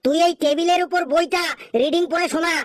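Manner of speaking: usual speaking voice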